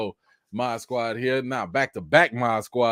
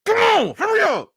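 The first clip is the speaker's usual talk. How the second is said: higher, louder come on hurry up; urgent yell; 6 dB louder than usual. Retold or imitated. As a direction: urge the dog on, exasperated. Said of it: "And I'm sitting here like".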